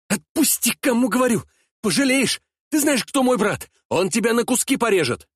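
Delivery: high, strained threatening yell; fighting the grip, shouting threats; struggling against restraints. Acted.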